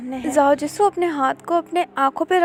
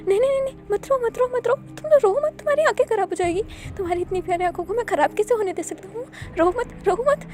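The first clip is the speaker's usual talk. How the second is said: much higher, fast, creepy coaxing; psycho soothing; 8 semitones higher, 4 dB louder, faster than usual. A narrator's reading. Doctor Hun hushes the crying captive, high, fast and deranged. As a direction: hush him like an unhinged doting doctor. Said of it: "एकदम psycho की तरह".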